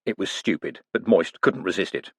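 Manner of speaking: ordinary delivery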